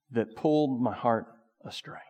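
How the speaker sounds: ordinary talk, nothing marked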